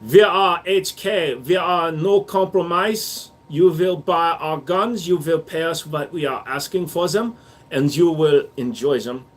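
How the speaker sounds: slow, stern mock German corporate voice; a hint of a German gun maker, deliberate; proud German manufacturer, slow and firm; mock German company voice. Speaking as the company.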